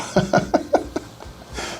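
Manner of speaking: a high, breathy laugh with no voice; sheepish laugh. Real, not acted. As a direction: laugh at yourself. Laughs at own lack of dancing. (petit rire soufflé)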